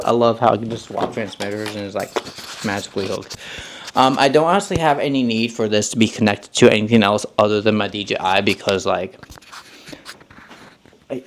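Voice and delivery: normal talking voice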